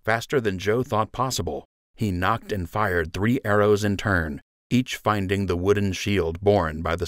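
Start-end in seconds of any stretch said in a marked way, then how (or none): none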